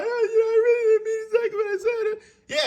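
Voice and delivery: high, whiny fake sob; hammed-up crying apology; mock crying. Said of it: "they cry".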